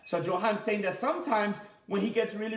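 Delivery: normal talking voice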